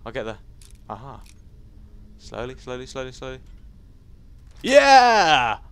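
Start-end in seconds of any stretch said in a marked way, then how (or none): 2.2-3.4 soft, repeated self-coaching; careful murmur. Real, not acted. Gamer picking a lock, careful and hushed. 4.6-5.6 long, loud, high cheer; triumphant cheer. Real, not acted. Gamer after unlocking a chest, triumphant yell.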